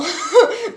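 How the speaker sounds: short high laugh; a quick bright burst of laughter; kurzes Lachen. Real, not acted. Laughing after her film remark. (kurzes, helles Auflachen)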